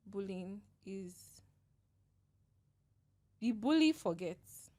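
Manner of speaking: slow, tearful and halting; fighting back tears, long pauses; after crying. Real, not acted. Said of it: "That was a good cry".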